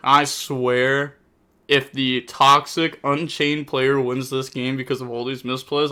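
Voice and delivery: normal talking voice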